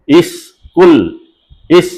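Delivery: "স্কুল" as "is", gap, "kul"; syllable split. Shown as a demonstration. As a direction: say "ইস" alone with the s sustained. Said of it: "এটা উচ্চারণ হচ্ছে ইস".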